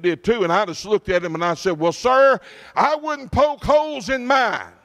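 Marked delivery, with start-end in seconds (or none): 1.8-4.7 sharp, higher-pitched comeback with a slow, pointed last line; angry retort. Retold or imitated. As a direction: snap back at the board member, offended. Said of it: "And that's the way I said. I was angry".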